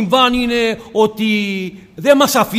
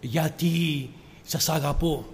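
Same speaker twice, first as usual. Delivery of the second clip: tender hush; 8 semitones lower, 12 dB quieter than usual. Acted. Lord answers the disciple tenderly.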